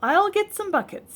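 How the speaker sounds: put-on girl volunteering brightly; eager helper. A narrator's reading. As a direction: jump in to help. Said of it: "said Angela".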